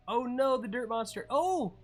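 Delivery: high, loud cry of dismay; alarmed race commentary. Real, not acted. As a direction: call out the mishap as it happens. Live reaction to a race mishap.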